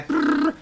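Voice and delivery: high rolled machine-gun rattle; imitated machine gun. Showing Bobby firing, imitated machine-gun noise. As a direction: trill a mock burst of gunfire, high-pitched.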